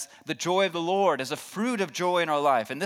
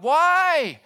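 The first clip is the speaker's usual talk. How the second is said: loud, very high acted cry; anguished high-pitched shout at God; screaming out to God; 10 semitones higher, 8 dB louder, much slower than usual. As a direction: cry out to heaven, full volume then easing slightly. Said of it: "wanting to scream out to God".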